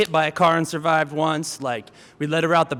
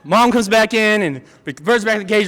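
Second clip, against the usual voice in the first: plain reading voice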